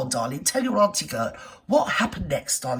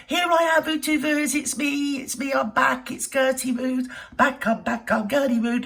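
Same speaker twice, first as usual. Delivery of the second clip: character voice; 6 semitones higher than usual. Acted. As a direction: burst in high as a cheeky old lady. Comic character intro catchphrase.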